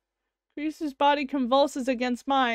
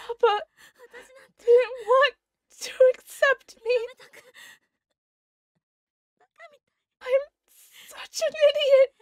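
voicing dying Kurisu; 9 semitones higher than usual. Voicing Kurisu confessing about her father, tearful. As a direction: play the dying girl, pained and upset.

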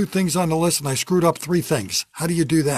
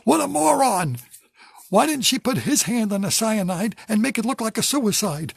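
high, shrill-free mock yell at the screen; imitating his wife yelling at the screen, high and excited; mock screaming at the TV; 7 semitones higher than usual. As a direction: yell at the TV like a true-crime fan. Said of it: "She's screaming at the screen".

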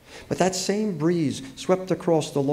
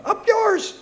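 very high-pitched squawked taunt; crying birds. A narrator's reading. Birds jeering at the people below, high squawked cry. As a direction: squawk it like a cheeky bird. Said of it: "the birds cried".

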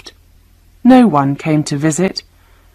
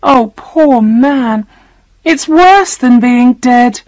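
high, slow, on the edge of tears; a girl about to cry over her hurt father; tearful; 8 semitones higher, 3 dB louder, slower than usual. A narrator's reading. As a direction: let the words tremble toward crying. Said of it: "She began to cry".

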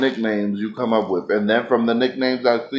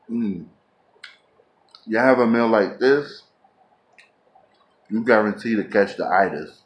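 speaking between bites; talking while chewing. Real, not acted. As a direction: mouth partly full. Seen 0: holds meat, bites at 1.9 and 3.1 while talking.

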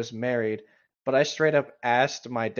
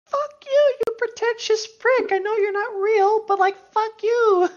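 much higher, louder outraged yell; angry outburst; 5 dB louder than usual. Real, not acted. Player yells at a fictional character, high, outraged outburst. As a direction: screech it at the fake character.

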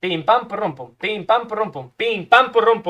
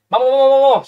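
loud excited chant of vamos; loud gamer hype chant; hyped cheering; 5 semitones higher, 7 dB louder, faster than usual. Real, not acted. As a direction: pump yourself up, chanting. Hyped cheering during play.

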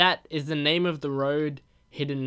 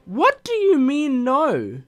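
high, loud, slow outrage; shocked protest at the screen; indignant disbelief; 10 semitones higher, 8 dB louder, slower than usual. Real, not acted. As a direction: demand an answer, baffled. Arguing with game character's reply.

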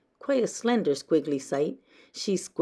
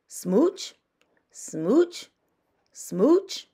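slow start, then smooches climbing higher and louder; joyful smooches; 5 semitones higher, 9 dB louder, much slower than usual. Acted. Happy fish smooching everyone, rising with joy. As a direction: build the smooches into a big happy finish.

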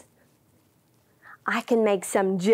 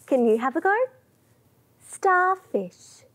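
ordinary delivery

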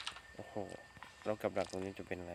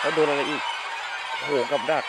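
alarmed shouting; 6 semitones higher, 11 dB louder than usual. Real, not acted. Hit another trap.

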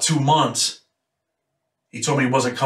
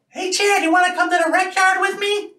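much higher, odd put-on voice imitating a man who had spoken to no one for years; eerie high-pitched inmate voice, friendly invite; [name]'s strange voice. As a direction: squeak it out like someone who forgot how to talk. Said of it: "And that kind of voice".

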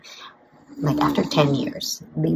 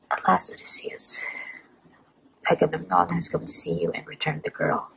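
livelier story reading. A narrator's reading. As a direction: give each king a clear spoken line. Reads the novel aloud.

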